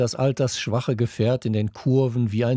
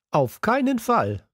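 much higher, emphatic; firm agreement; 12 semitones higher than usual. A narrator's reading. Boy backing up the class, emphatic and higher. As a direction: back it up hard. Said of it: "bekräftigte Luca".

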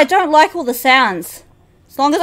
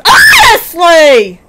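exasperated; 6 dB louder, slower than usual. Real, not acted. Frustrated outburst at game.

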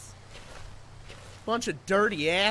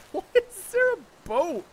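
high, laughing question; 6 semitones higher than usual. Real, not acted. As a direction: giggle through the words. Laughing at burning boat.

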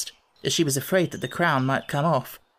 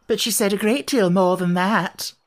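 higher-pitched, fretful moan; 5 semitones higher, 4 dB louder than usual. A narrator's reading. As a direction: moan it in dismay.

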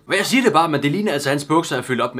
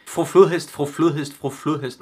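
"fru Flodhest" three times in quicker succession; tongue-twister repetition. Shown as a demonstration. Reader testing the tricky name three times in a row, brisker repetition. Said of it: "Prøv at sige det tre gange i træk".

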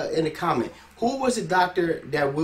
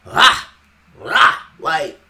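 mock ad-lib; 10 dB louder, much slower than usual. Mocking the rapper's ad-libs.

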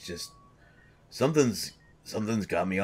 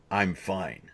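ordinary delivery

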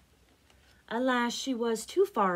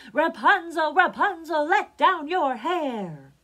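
prince's loud, high call; loud singsong shout to someone above; 9 semitones higher, 13 dB louder, slower than usual. A narrator's reading. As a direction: call up to the tower, last words drawn out. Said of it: "the prince came and called".

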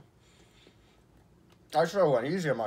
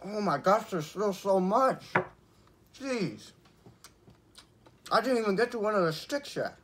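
higher, talking past a mouthful; mouth full; 7 semitones higher than usual. Real, not acted. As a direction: chew and talk, strained. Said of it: "my mouth is getting cut up".

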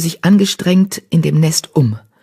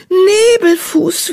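high, drawn-out exclamation; astonished echo; 4 dB louder, much slower than usual. Acted. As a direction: repeat the name in amazement, stretching it. Repeats friend's name in surprise.

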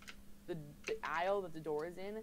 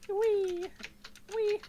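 squealing; 9 dB louder than usual. Real, not acted. Spinning in chair, squeals.

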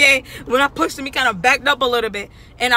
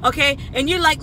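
ordinary talk

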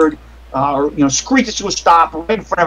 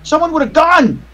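high-pitched alarm cry; acted scream of alarm, pitch jumping up; panicked crowd screaming; 11 semitones higher than usual. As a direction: cry out a warning like a panicked bystander. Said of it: "people screaming".

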